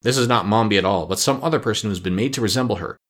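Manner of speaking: usual speaking voice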